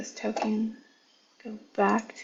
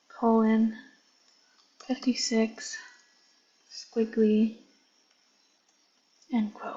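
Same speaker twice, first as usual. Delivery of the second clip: reading code aloud slowly, piece by piece; slow dictation; slower than usual. Real, not acted.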